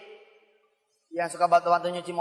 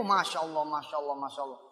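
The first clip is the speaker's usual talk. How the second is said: each Masya Allah softer and lower; fading awe; 4 semitones lower, 8 dB quieter than usual. Real, not acted. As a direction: let the wonder die away. Marvelling at Edi's reward.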